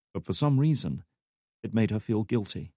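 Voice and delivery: ordinary delivery, nothing marked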